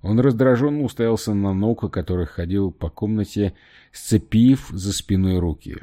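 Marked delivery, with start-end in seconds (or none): none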